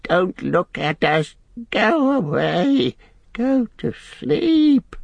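high, slow pleading whimper; Gollum whimpering. Acted. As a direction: beg in a drawn-out creature wail. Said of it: "he whimpered with his face to the ground".

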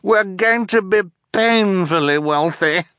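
high-pitched greedy exultation; affected villainess glee; crying out gleefully. A narrator's reading. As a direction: cry it high, drunk on triumph. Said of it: "Esme cried".

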